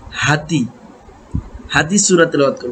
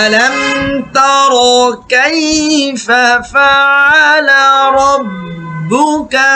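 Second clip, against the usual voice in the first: higher, slow melodic chant; Quran recitation; 6 semitones higher, much slower than usual. Shown as a demonstration. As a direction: recite it sung and stretched. Model recitation with music. (সুরেলা তেলাওয়াত)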